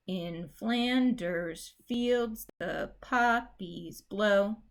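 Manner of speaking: slow, da-DUM iambic beat; iambic reading. Shown as a demonstration. Teacher reading the line in iambic rhythm, slow. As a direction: tap out the iambs as you read. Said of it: "try doing the rest of it in iambic".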